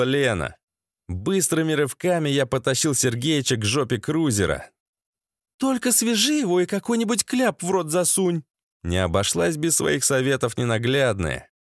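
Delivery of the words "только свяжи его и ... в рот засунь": high-pitched female instructions; bossy woman. Acted. Lena instructs Sasha on the prisoner, high-voiced order. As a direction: advise him in a raised feminine pitch.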